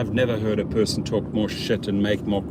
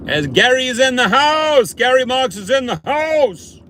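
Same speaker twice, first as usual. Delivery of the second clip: much higher, loud hype call; shout-out; 12 semitones higher, 6 dB louder than usual. Acted. Greeting a viewer in the chat, loud high hype call. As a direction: announce him like a ring announcer.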